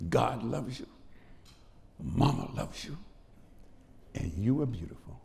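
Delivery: hushed motherly voice, retold; tender reassurance. Mother comforting her frightened son, imitated tender reassurance. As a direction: soothe the hurt child softly and gently.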